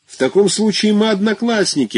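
high, delighted exclamation; exclaiming. A narrator's reading. Petrishchev greeting his schoolmate Luzhin, excited. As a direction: burst out in glad recognition. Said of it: "воскликнул тот".

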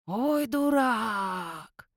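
long, drawn-out mocking groan of scorn; scornful snort. A narrator's reading. Watchman mocks the treasure-digging werewolf, drawn-out snort. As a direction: stretch it right out, snorting at the idiot. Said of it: "фыркнул Ионуц".

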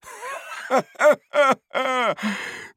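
high mocking cackle; scornful laugh. A narrator's reading. Бардак senses the doubt and laughs, high scornful laugh. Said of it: "Он как-то это почувствовал и рассмеялся".

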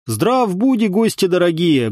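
high-pitched, narrator's restrained boom; booming greeting. A narrator's reading. Headman's wife welcoming guests, lightly voiced boom. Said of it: "грохнула она".

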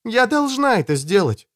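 put-on high girl's voice; high-pitched, resolved. A narrator's reading. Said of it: "сказала она".

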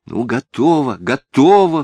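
high, loud yielding outburst; crying out. A narrator's reading. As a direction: give in with a rising exclamation. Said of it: "вскрикнула Софичка".

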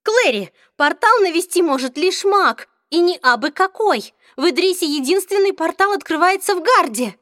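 high, loud thrilled question; excited. A narrator's reading. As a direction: blurt it out with excitement. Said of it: "возбуждённо переспросила Изабель".

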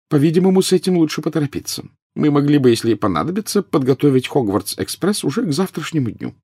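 high-pitched female professor, decisive; brisk, businesslike professor. A narrator's reading.

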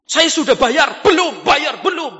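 high, loud back-and-forth argument; hammed-up squabbling; acted quarrel. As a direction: play both sides of a heated dispute. Acted quarrel over payment.

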